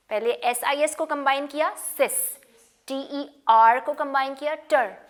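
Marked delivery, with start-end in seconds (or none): none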